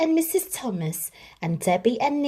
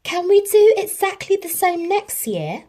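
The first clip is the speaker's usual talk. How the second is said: much higher, louder eager ask; excited child; 11 semitones higher, 7 dB louder than usual. A narrator's reading. Nicky begging for the same Christmas next year, excited. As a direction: bubble over with joy. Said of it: "said Nicky".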